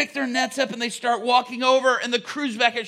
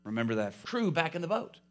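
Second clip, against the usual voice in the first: usual speaking voice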